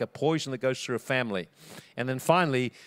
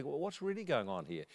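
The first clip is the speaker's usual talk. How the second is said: normal talking voice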